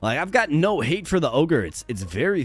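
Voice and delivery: ordinary delivery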